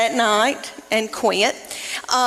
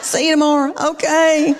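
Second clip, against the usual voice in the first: brightly higher, sugary cheerful farewells; sweet schoolgirl goodbyes; 6 semitones higher, 3 dB louder than usual. Retold or imitated. Daughters waving to teachers, sugary higher goodbye. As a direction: chirp it up like a happy kid waving.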